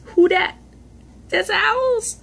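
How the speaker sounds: squeaky playful voice; high goofy voice; silly high voice. Pretend. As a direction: pitch way up and joke.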